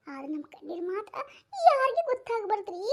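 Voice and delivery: ordinary delivery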